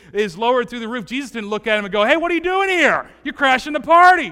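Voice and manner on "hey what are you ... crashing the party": higher, louder mock indignation; hammed-up outrage at an intruder; mock indignant. Jesus pretending to scold the paralytic, mock loud indignation.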